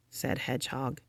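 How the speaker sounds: ordinary delivery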